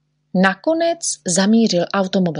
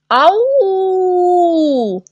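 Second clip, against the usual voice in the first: long, high acted "ow" howl; high drawn-out ouch; cry of pain; 11 semitones higher, 3 dB louder than usual. A narrator's reading. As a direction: yelp in pain, drawing it out. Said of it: "vykřikl neználek".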